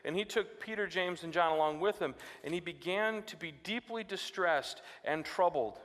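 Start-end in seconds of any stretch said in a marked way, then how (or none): none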